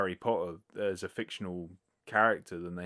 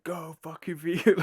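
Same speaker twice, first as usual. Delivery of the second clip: shouting; 9 semitones higher, 5 dB louder than usual. Retold or imitated. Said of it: "it's just him going".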